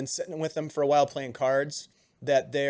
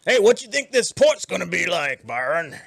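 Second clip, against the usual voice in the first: loud, rough half orc call; a burly half orc sailor hailing his mate; gruff sailor voice; 4 semitones higher, 8 dB louder than usual. Acted.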